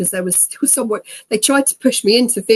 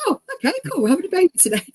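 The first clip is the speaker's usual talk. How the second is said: much higher, airy and carefree; cheerfully oblivious; 12 semitones higher, much faster than usual. Retold or imitated. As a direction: announce it like a fun day out. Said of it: "completely unaware of the situation".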